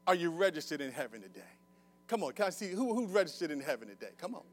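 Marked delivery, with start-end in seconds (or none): none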